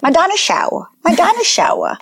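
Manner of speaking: high imitation of a toddler, "dinosaur" as dah-no-show; toddler accent. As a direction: raise the pitch, turn "dinosaur" into dino-show-uh. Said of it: "saying dinosaur with an accent".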